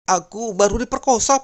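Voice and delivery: high, put-on wife voice; voiced wife. Wife on the phone to her husband at the office, upset.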